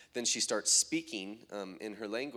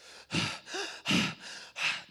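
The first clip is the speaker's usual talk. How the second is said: imitated gasping. Preacher showing how the girl began after collapsing, imitated gasping. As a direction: mimic someone heaving for breath. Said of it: "And then she started".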